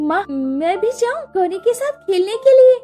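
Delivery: put-on high eager little girls; child voices. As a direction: ask sweetly like young sisters. Sisters ask to join play. (बच्चियों की उत्सुक आवाज़)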